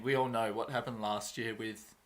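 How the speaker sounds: ordinary delivery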